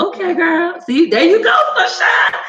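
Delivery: high, loud cheer with laughter; delighted approval. Acted. Creator approves a follower's bundle offer, delighted squeal. Acted delighted reply to good pitch.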